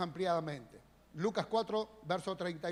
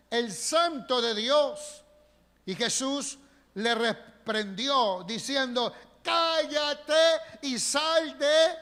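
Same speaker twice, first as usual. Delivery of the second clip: loud, high, slow scripture reading; preaching at full force; 5 semitones higher, 9 dB louder, slower than usual. Acted. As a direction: proclaim the passage with power. Reading the demon passage loudly. (lectura predicada a todo pulmón)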